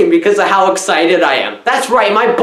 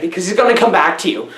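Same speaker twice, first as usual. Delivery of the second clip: usual speaking voice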